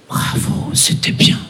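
hushed, fully whispered praise; whispered compliment. Retold or imitated. Lady comes up after the show, whispering. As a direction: imitate a lady whispering, no voice at all.

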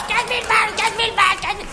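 high, strained, frantic repetition; mock hysteria. Imitating Gérard frantic over stolen cash, mock hysteria. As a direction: repeat it in a high panicky impersonation. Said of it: "sur un mode complètement hystérique".

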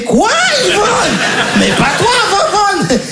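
high, slow shocked shout; outraged disbelief. Retold or imitated. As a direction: cry out in stunned outrage. Outraged reaction to Yvonne.

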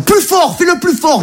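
very high-pitched frantic command; acted high-pitched exhortation; urgent urging. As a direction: urge them on from the other end of a call. Voice on phone urging him on.

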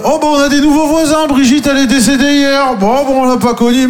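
breezy gossip about the dead neighbours; high, light mock small talk on the balcony; mock cheerful neighbour. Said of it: "Et t'es là, alors, euh".

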